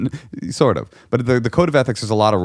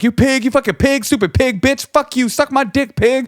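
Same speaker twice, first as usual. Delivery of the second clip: angry, matching his energy. Retold or imitated. Interpreter matching the caller's rage, screaming insults at police. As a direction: spit every insult like a tirade. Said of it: "are you saying it angry".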